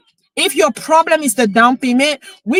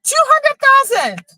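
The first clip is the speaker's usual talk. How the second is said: overwhelmed, pitching right up as it hits you; emotional outburst at seeing his first house again; 12 semitones higher than usual. Real, not acted. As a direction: voice jumping high with real emotion. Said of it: "I'm so emotional".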